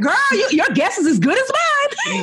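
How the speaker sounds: put-on very high, loud, sassy girl talk; cheeky shrug. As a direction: toss it off with a cheeky grin. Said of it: "But I'm like".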